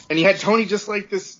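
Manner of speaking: ordinary talk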